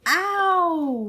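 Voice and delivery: sharp high ow; pained yelp. Acted. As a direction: yelp as you hit the floor. Pulled off couch.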